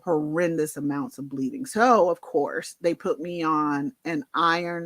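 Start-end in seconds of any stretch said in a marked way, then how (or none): none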